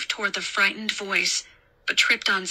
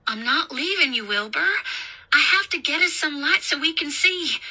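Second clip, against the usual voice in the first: higher, reassuring voice; raised pitch, climbing with insistence; insistent babysitter; 7 semitones higher than usual. A narrator's reading. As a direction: reason with a frightened child.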